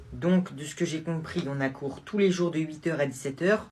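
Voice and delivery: normal talking voice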